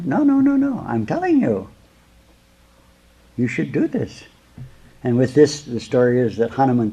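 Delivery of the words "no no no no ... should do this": high, insistent refusing servant; defiant servant. Acted. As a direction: refuse the master, voice jumping high. Hanuman refuses his master.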